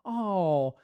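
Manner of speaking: drawn-out sarcastic compliment; slow, mock-admiring, laid on thick. Shown as a demonstration. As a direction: overdo the praise so it sounds insincere. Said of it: "It just sounds like I'm being sarcastic".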